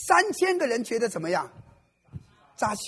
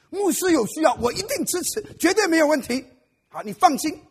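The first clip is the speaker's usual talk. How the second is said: eager promise; 8 semitones higher, 9 dB louder than usual. Acted. Brother eagerly agrees to preach.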